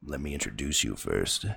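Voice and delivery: very low show announcer voice; deep, grand guest introduction. Pretend. Host introducing a returning guest, low announcer voice.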